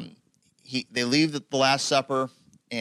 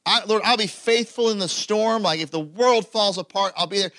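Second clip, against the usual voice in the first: brave vow; 8 semitones higher, 4 dB louder than usual. Retold or imitated. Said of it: "we brace for".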